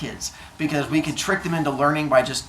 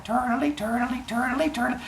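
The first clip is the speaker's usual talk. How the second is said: high, soft repetitive turtle chant; little turtle; 9 semitones higher, 7 dB quieter than usual. Acted. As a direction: chug along in a tiny high voice. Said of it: "the turtle just keeps going".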